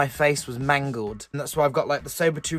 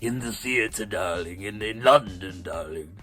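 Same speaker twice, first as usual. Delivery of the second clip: put-on much lower, soft mid-Atlantic English accent, darling trailing off; Tallulah's theatrical English accent; 7 semitones lower, 3 dB quieter than usual. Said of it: "a weird English accent".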